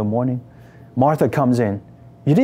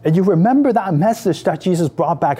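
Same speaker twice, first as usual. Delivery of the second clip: ordinary delivery, nothing marked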